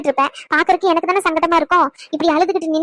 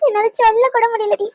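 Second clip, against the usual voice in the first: higher, tearful voice asking why she wasn't told; tearful pleading; 6 semitones higher than usual. Acted. Mother to hospitalised daughter, tearful higher pleading. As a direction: plead through tears, pitch rising. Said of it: "இங்க ஒரே அழுகையோ அழுகை".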